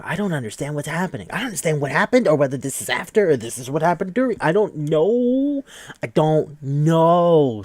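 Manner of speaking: high, loud, baffled rant; building to a stretched-out I don't know; exasperated confusion. Real, not acted. Baffled by the cut, loud exasperated confusion.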